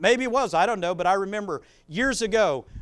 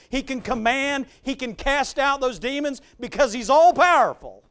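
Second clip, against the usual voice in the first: higher, louder preaching climax; 6 semitones higher, 6 dB louder than usual. Acted. Preacher telling guys and ladies Jesus has control, loud and rising. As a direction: build to a triumphant close.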